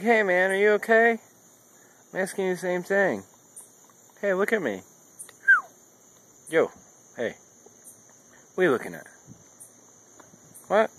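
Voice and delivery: high-pitched talk to a dog; talking to a pet. Real, not acted. Owner checking on his dog, high-pitched. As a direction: pitch it up to get the dog's attention.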